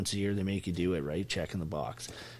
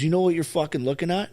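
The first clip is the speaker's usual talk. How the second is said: retold sergeant challenge; 7 semitones higher, 5 dB louder than usual. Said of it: "He goes".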